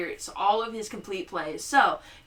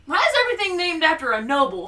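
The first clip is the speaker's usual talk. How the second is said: ordinary delivery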